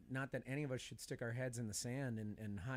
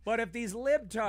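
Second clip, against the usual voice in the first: much higher and louder, indignant pundit; whiny high protest; 10 semitones higher, 9 dB louder than usual. Pretend. Improv skit, playing Hannity protesting to his therapist, high and loud. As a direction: whine it like a cornered pundit.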